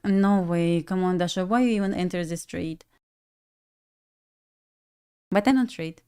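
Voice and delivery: normal talking voice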